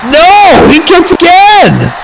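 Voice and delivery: high, drawn-out disbelieving call; excited shout. Real, not acted. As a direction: yell the kick-out in disbelief. Near fall call.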